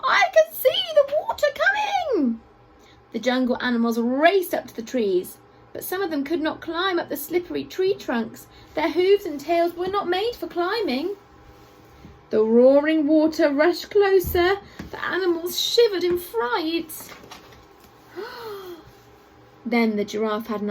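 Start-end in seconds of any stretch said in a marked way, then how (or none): 0.0-2.4 shrieking bird alarm; cockatoo squawk. A narrator's reading. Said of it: "squawked the cockatoo". 18.2-18.7 soft high gasp of fright; quick scared intake of breath; frightened gasp. A narrator's reading. Animals shivering in fright.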